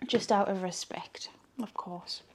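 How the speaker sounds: hushed aside; whispering. Real, not acted. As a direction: drop to a whisper. Respectful aside after swearing.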